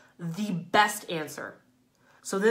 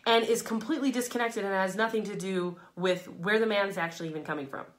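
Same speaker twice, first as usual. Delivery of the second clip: ordinary talk, nothing marked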